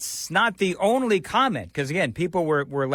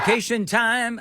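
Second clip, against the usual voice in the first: put-on a quick, raised parroting of her Detroit accent; mock Detroit accent; 5 semitones higher than usual. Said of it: "she doesn't speak like that".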